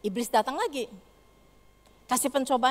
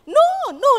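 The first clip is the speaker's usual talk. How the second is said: put-on very high, loud child's wail; child's frightened cry; 15 dB louder than usual. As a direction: scream refusal like a terrified kid. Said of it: "dia bilang". (jeritan anak ketakutan, sangat tinggi dan keras)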